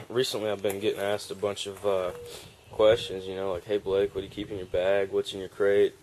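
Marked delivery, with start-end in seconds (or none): none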